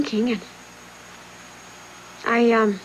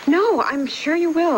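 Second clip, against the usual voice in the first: higher, slow, emphatic reassurance; insistent reassurance; 7 semitones higher, slower than usual. Acted. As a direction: insist slowly to cover the doubt. Insists after being doubted.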